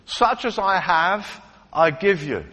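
lightly acted healer, slow and deliberate; Simon. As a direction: hold their attention, then deliberately offer the gift. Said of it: "That's his first line".

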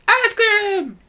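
high, drawn-out excited cry; mock scream. Recalling calling out for ice cream, high mock scream. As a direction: squeal it out way up high. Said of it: "I guess you're screaming for it then".

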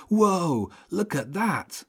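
high-pitched exclamation of amazement; amazed. A narrator's reading. Boy sees birds pulling the boat, amazed.

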